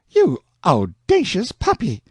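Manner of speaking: high, slow indignant scolding; outraged Aunt Sally. A narrator's reading. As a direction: bite out the insult, scandalized aunt. Sally wipes off the kiss.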